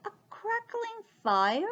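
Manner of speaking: high, tentative little mouse; timid mouse. Acted. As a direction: venture it hopefully. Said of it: "ventured the mouse".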